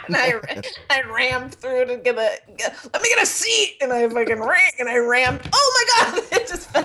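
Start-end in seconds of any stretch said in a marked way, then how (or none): 2.9-3.8 high, pushy little cry; mock urgent, high; eager quote. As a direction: squeeze in, grabbing for a seat. Retold self rushing onto train.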